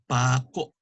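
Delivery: PAKO, long PA, KO cut off in the throat; glottal catch. Shown as a demonstration. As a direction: stress PA, stop KO with a throat catch. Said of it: "You hear the catch?".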